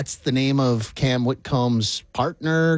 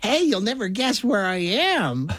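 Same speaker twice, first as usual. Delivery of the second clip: playful, higher-pitched caller voice; mock video caller; 6 semitones higher than usual. Video caller showing off from the movie theater, mock excited.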